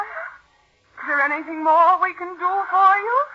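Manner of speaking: mocking sweetness. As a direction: drip false kindness over her. [name] mocks the dying aunt.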